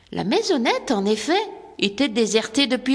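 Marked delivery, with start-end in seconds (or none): none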